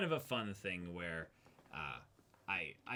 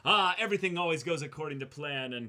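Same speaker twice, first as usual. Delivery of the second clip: hammed-up, higher and louder grand villain tone; mock villain grandeur; 7 semitones higher, 8 dB louder than usual. Mocking the villains' lofty motives in a louder, higher voice. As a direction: puff it up like a pompous villain.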